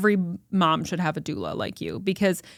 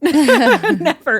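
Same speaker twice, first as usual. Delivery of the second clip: NEVER burst out louder and higher through a laugh; 8 semitones higher, 8 dB louder than usual. Real, not acted. To her host about inversion, laughing loudly. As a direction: laugh it out, half-joking but firm. Laughing while refusing a repeat.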